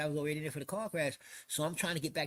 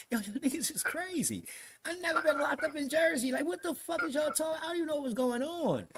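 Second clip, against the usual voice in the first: high-pitched retold protest; worked-up, incredulous retelling; exasperated outburst; 10 semitones higher than usual. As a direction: argue it way up high, in disbelief. Retold outburst at jail staff.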